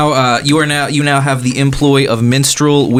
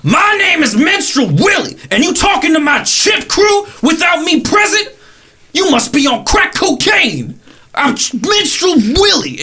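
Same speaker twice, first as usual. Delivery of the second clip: Minstrel Willie voice. Acted. Minstrel Willie confronting the stranger over his chimps, high boastful shout. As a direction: puff up and shout like a cocky street musician. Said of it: "He goes".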